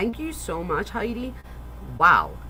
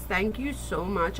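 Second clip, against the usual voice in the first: ordinary talk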